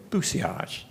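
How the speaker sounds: bussillage said "boo-see-AHZH", no L; French-style pronunciation. Shown as a demonstration. Showing listeners the newly learned word bussillage, French pronunciation with silent L. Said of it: "Now notice I'm pronouncing the word".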